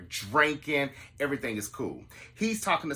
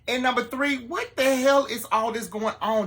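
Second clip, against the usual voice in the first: fed-up rant; 11 semitones higher, 5 dB louder than usual. Real, not acted. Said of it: "lost my mind".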